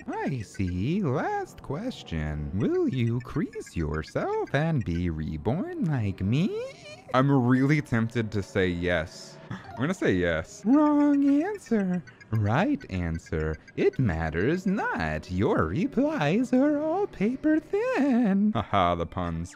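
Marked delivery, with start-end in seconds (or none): 0.1-6.5 slow, eerie princess swooping upward; slow, unsettling royal questioning; creepy Princess Peach. Acted. 10.6-18.5 slow, eerie princess swooping upward; slow, unsettling royal questioning; creepy Princess Peach. Acted.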